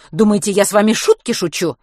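put-on strict vice-principal catching boys outside; stern teacher. A narrator's reading. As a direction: interrogate like a strict head teacher.

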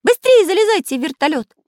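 high, loud urgent cry; Карандаш agitated. A narrator's reading. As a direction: anxiously hurry the others up. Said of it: "волновался Карандаш". (взволнованный крик, торопя)

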